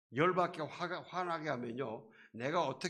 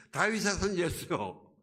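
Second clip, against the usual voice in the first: louder cry for mercy; loud plea; 5 semitones higher, 6 dB louder than usual. Retold or imitated. Blind beggar shouting back over the jeering crowd, loud plea.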